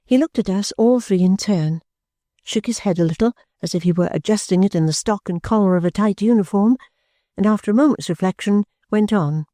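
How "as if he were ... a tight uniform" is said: ordinary delivery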